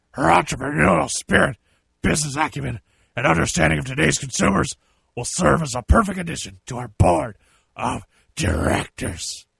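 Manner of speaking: heavy put-on wrestling boss voice, punched phrase by phrase; Vince McMahon. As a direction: impersonate the boss, dragging out the last words. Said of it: "do my best Vince McMahon impersonation".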